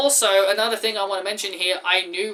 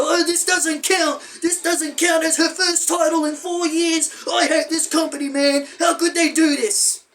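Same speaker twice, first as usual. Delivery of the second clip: whiny fan mockery; 8 semitones higher than usual. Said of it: "all of her little fans having a little cry".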